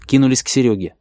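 usual speaking voice

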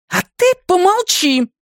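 high, sharp command to be silent; irate woman snapping; angry bark. A narrator's reading. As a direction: bark it at them, pitched high. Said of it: "рявкнула Мэгги".

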